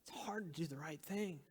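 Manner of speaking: soft unvoiced reflection; whisper. Real, not acted. Pastor admitting to listeners, whispered. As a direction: whisper it as a heavy truth.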